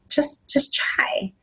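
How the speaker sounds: soft whispered just try; imitating a hushed plea; whispered urging. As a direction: coax it in a whisper. Mentor's gentle urging retold.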